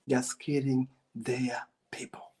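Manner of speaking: breathy whisper, like sharing a secret; whispered aside. Acted. Lecturer to his audience, a whispered aside. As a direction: drop to a whisper to let it land.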